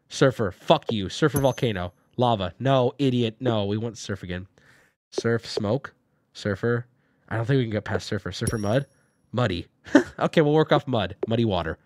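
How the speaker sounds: ordinary delivery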